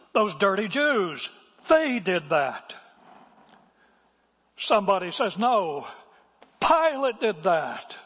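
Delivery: high, loud, drawn-out oratory; W A Criswell. Retold or imitated. Preacher re-enacts his pastor's dramatic sermon, imitated booming pulpit voice. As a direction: thunder each accusation slowly, stretching the key words. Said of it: "He said it in a voice that I can't muster".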